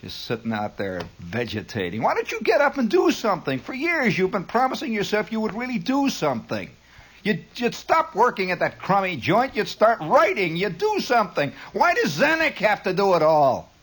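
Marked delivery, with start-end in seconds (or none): 2.0-13.7 higher-pitched, exasperated harangue; mock nagging. Host goading idle listeners to do something, mock nagging. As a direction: nag them in a put-on higher voice.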